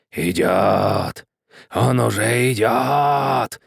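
slow ghostly skeleton moan; moaning, teeth chattering. Acted. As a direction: moan it through clattering teeth. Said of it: "простонал скелет, клацая зубами". (стон скелета, клацая зубами)

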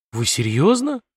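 incredulous question; genuine amazement. A narrator's reading. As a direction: ask in frank disbelief. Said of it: "В голосе диспетчера прозвучало неподдельное изумление". (с неподдельным изумлением)

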